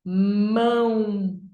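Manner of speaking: Mão drawn out as "mmmãããо"; one stretched syllable. Shown as a demonstration. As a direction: stretch Mão slowly without a break. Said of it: "uma sílaba". (Mão esticado numa sílaba só)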